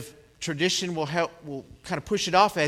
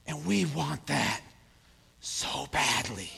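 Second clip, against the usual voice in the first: hushed longing; 4 semitones lower, 7 dB quieter, slower than usual. Real, not acted. Hushed longing after climax.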